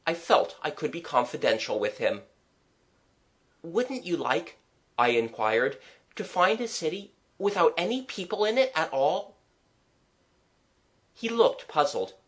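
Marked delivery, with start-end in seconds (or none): 3.6-4.6 put-on higher, lilting child's question; lightly voiced eager child; curious child. Child asking the artist about an empty city, curious. 6.2-9.4 put-on higher, lilting child's question; lightly voiced eager child; curious child. Child asking the artist about an empty city, curious.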